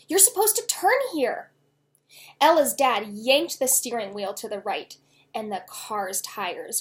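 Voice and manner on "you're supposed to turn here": raised, urgent correction; urgent cry. A narrator's reading. Girl warning dad of the missed turn, urgent. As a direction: snap the warning out. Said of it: "she cried".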